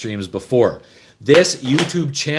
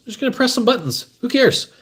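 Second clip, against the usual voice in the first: breezy don't care; 12 semitones higher than usual. Real, not acted. Careless gimbal setup.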